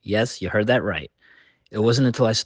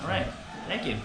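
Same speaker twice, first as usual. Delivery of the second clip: hushed; 4 semitones higher, 7 dB quieter than usual. Real, not acted. Talking inside a quiet shrine.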